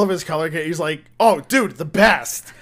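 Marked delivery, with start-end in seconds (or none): 1.2-2.5 loud, high, emphatic bro enthusiasm; gleeful agreement. Retold or imitated. Imitating his buddy agreeing about a woman, enthusiastic.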